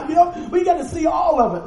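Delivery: high, loud laugh spilling into the words. Real, not acted. Preacher laughing at his own meltdown story, a loud high laugh. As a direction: laugh through the line at yourself.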